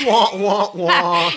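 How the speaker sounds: imitated wah-wah-wah, falling at the end; mock sad trombone. Host mocking the bit that got destroyed, sad-trombone wahs. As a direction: sound a comic failure trombone through a laugh. Mimics a failure sound effect.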